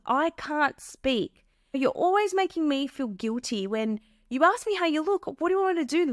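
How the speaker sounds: high, heated, indignant comeback; defensive partner. Retold or imitated. Partner turning blame back on the asker, heated and indignant. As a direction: throw the blame right back at her. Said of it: "And they might say".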